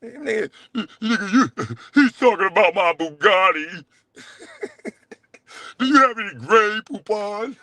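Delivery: high, cackling taunt; laughing mock posh aside; laughing mockery. Real, not acted. Mocking his rival's fancy talk, high and laughing.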